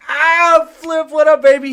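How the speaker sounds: high, loud hype shout-out; excited greeting. Real, not acted. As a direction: greet him like a hype man. Greeting a guest arriving in chat.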